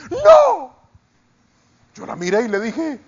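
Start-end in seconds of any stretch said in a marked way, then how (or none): 0.1-0.7 a high, louder squealed "¡No!"; excited woman's cry. Retold or imitated. Resort lady spotting the pastor, high squealing "no". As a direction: squeal with delighted disbelief. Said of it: "aparece una señora ... y dice".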